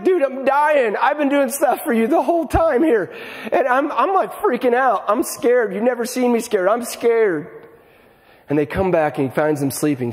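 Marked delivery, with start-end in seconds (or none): 0.0-7.6 pleading, frightened. Acted. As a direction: beg your friends not to leave you alone. Jesus voiced pleading with sleeping disciples.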